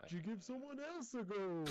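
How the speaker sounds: very high, soft clown voice; tiny high-pitched clown needling the player. Acted. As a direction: pipe it up high and small, like a clown. GM speaks as the clown villain.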